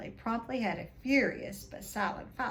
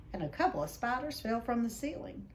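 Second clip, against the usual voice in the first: usual speaking voice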